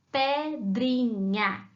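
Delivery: "pedrinha" slowly split as pe-dri-nha; syllable by syllable. Shown as a demonstration. Teacher showing children the syllables to find, slowly split. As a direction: sound out "pedrinha" with gaps between syllables. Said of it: "quais sílabas você vai precisar".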